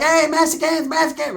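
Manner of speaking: high-pitched impression of a yelping little guy; mock calling out. Tossed dwarf calling to the others, high mock yell. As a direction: call out in a high, put-on voice.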